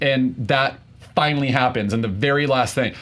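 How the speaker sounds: usual speaking voice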